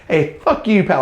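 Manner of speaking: high, loud, hammed-up tough-guy bark; mock tough guy. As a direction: play a fake tough guy squaring up.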